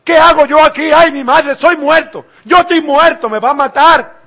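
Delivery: high, loud cry of terror; as if sure he will die now; terrified outcry. Acted. Isaiah in God's presence cries out in terror. Isaiah fears death before God.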